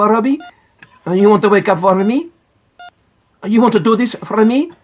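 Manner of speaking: put-on gentle coaxing with an Italian accent; Italian-accented footballer urging a boy on. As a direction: speak as a visiting Italian star. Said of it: "I recognise his Italian accent".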